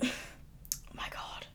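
breathless laughing whisper. Real, not acted. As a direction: giggle it out under the breath. Laughing at her own burps.

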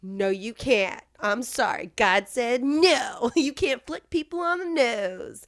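lightly voiced stern refusal, slow; stern preacher voice. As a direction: lay down the law like a preacher. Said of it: "this guy was like".

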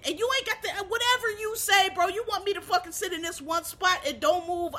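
high-pitched, worked-up pleading of a point; exasperated insistence. Acted. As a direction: argue it in fed-up disbelief. Acting out obeying orders, exasperated.

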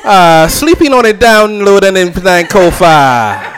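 loud hammed-up island accent of a mom; imitating Trinidadian mom. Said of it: "don't know what the fuck she be saying".

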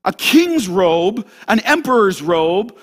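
ordinary talk, nothing marked

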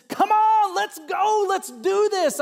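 high, loud, fired-up call to go; rally the people like Caleb, urging them forward; eager rallying cry. Retold or imitated. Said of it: "You can just imagine this".

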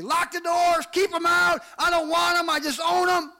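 high, loud shout; angry rejection. Acted. Father shouting to the community to shut out his son, angry rejection. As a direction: shout it like barring someone from the house.

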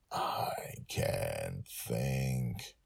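slow, hushed and hoarse, barely there; hoarse whisper. A narrator's reading. Hungover father whispers hoarsely to his daughter. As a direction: groan it out in pain. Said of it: "he whispered hoarsely".

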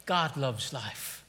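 low, soft and slow; soft closing refrain. Real, not acted. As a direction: let it fall softly after the shouting. Quiet closing repetition.

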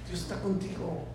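ordinary talk, nothing marked

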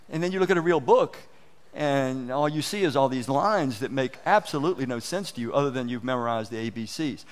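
ordinary talk, nothing marked

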